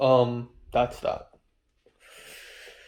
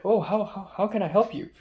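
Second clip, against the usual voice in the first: higher, lilting stab at Irish; amateur Irish accent, higher than usual; attempted Irish accent; 7 semitones higher than usual. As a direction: imitate an Irish woman's accent, pitched up. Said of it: "trying to do an Irish accent".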